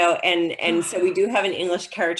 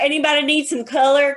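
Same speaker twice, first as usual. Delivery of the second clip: higher, louder offer to the room; beauty-parlor woman; 6 semitones higher, 5 dB louder than usual. Acted. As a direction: call out like a salon regular. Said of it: "everybody, like, pulling up their lipstick".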